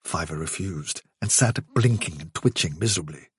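ordinary delivery

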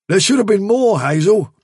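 a touch of alarm; Bigwig's gruff worry. Acted. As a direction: press the point, fretting. Bigwig voiced in reading.

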